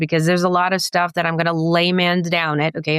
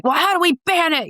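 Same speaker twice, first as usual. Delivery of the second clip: mock patriot; 10 semitones higher than usual. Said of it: "and they're like".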